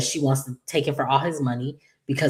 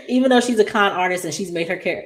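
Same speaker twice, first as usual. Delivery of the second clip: normal talking voice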